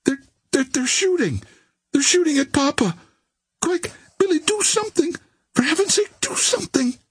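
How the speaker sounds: very high frantic pleading; stammer, then plead in rising panic; panicked cry. A narrator's reading. Barbara sees her father shot at, panicked. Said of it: "cried the girl".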